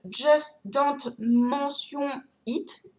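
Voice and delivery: English title said the French way; slow, careful French pronunciation of English words; French accent. Real, not acted. Vlogger apologising for poor English, French-accented title. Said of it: "je vous prononce le titre à la française".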